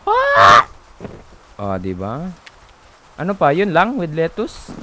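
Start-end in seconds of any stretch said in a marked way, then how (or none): 0.1-0.6 sharp high scream of fright; startled scream. Pretend. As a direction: scream out in sudden shock. Sudden scream.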